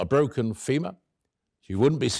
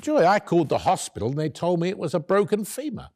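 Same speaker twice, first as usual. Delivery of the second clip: higher-pitched, insistent protest; disbelieving protest; 6 semitones higher than usual. Retold or imitated. Husband repeats what the hospital said, in disbelief. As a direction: protest it as if it can't be true. Disputing the shocking diagnosis.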